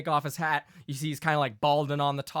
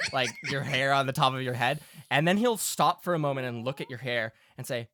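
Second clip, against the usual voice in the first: normal talking voice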